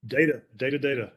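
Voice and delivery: ordinary delivery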